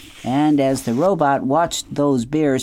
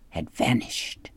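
whispered; 3 semitones lower, 7 dB quieter, slower than usual. A narrator's reading. Suspenseful chapter ending.

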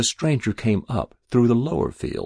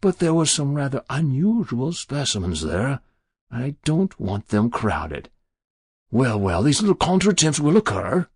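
coy, mannered excuse-making; simpering; 6 semitones higher, 3 dB louder than usual. Acted. Stranger excusing his crowded collecting box, simpering. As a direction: simper through the apology. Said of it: "he said with a simper".

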